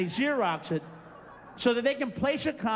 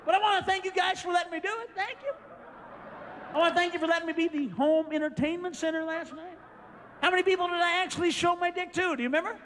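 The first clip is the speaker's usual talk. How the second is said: mock gratitude; 8 semitones higher than usual. Mock thanking hosts.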